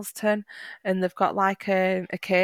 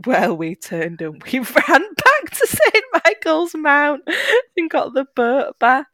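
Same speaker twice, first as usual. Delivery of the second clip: laughing through the words; 8 semitones higher, 7 dB louder than usual. Real, not acted. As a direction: tell it while cracking up. Laughing retelling a jellyfish scare.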